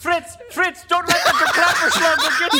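high, loud, laughing German soldier warning; hammed-up warning to Fritz; mock German soldier. Mock German war movie.

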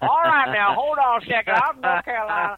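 put-on a deliberately terrible North Carolina accent, booming then settling; bad North Carolina accent. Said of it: "That's the worst North Carolina accent".

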